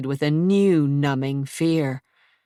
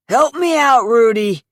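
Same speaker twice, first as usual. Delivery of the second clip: high, loud call to his partner; 7 semitones higher, 7 dB louder than usual. Acted. Tall thug calls to Rudy for help, loud call. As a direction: shout for help mid-fight.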